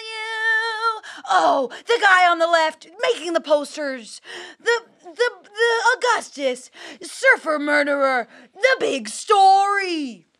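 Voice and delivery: slow, overwrought trailer-style narration; hammy true-crime series voice; dramatic documentary voice. Shown as a demonstration. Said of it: "it's your documentary voice".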